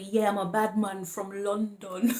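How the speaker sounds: slow, put-on London accent; playful London accent. Reader clowning for viewers, mock London bad-man accent. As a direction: ham up a London accent, drawing it out. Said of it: "I'm being playful".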